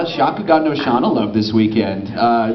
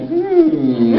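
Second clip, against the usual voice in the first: knowing hum; 3 dB louder than usual. Retold or imitated. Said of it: "it was just kinda like".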